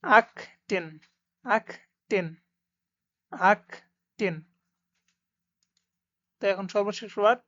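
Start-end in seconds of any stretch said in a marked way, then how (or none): 0.0-4.4 আকটেন, the last take very slow; careful teaching read of আকটেন; German achten. Shown as a demonstration. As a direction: stretch আকটেন out slowly. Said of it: "খুব সুন্দর একটা word".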